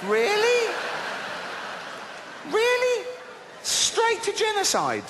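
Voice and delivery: high disbelieving rant at the Bible's God; outraged high rant. Acted. Ranting at God's overreaction.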